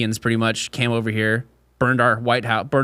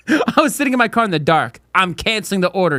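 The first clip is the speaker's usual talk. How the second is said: indignant complaint; 6 semitones higher than usual. Retold or imitated. Retold driver complaint.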